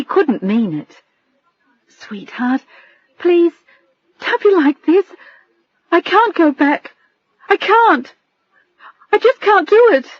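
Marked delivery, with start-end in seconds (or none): 1.9-10.1 rising higher and louder, close to tears; wife begging her husband not to force the abortion, near tears; desperate pleading. A narrator's reading. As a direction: beg him, breaking more with each line.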